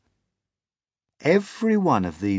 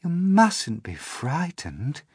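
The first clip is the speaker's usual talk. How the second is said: higher, slow, gentle ladybird; kindly ladybird; 7 semitones higher, slower than usual. Acted. Ladybird reassuring frightened James, high and slow. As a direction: soothe him gently and slowly. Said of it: "the ladybird said kindly".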